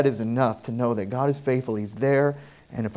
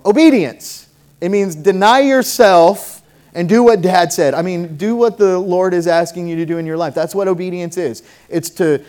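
loud preaching on obedience; full-force preaching; 3 semitones higher, 7 dB louder than usual. Acted. To the church, on obeying God, loud preaching. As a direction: press the point home hard.

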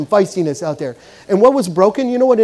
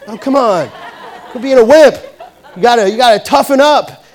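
raised, higher-pitched coach's rebuke; scolding coach; 5 semitones higher, 6 dB louder than usual. Retold or imitated. Coach tells hurt player to toughen up, loud scolding. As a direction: re-enact chewing out a player, loud and impatient.

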